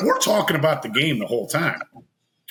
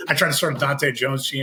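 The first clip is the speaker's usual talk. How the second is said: normal talking voice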